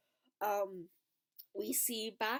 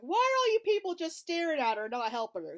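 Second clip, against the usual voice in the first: indignant outburst; 8 semitones higher, 9 dB louder than usual. Retold or imitated. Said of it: "I'm like".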